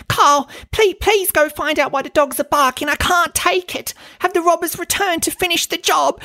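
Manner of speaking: very high, hammed-up pleading in a put-on voice; over-the-top terrified socialite voice; mock panicked Dorit. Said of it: "having these Vietnam War flashbacks".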